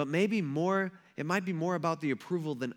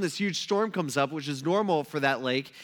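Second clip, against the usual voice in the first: ordinary delivery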